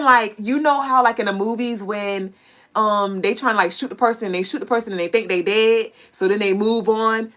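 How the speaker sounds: normal talking voice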